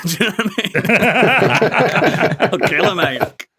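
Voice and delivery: slow, laughing loudly; cracking up mid-sentence; laughing hard. Real, not acted. Cracking up at own joke.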